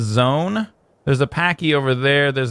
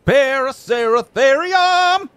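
high, drawn-out cry of the name; hyped announcement; 10 semitones higher, 4 dB louder, much slower than usual. Real, not acted. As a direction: stretch it out like a big reveal. Repeats creature name as a cry.

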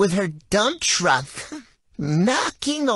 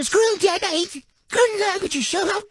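mock sleep yelling; 9 semitones higher, faster than usual. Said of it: "she'll go".

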